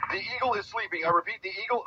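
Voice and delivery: high alarmed agent call; alarmed radio call. Pretend.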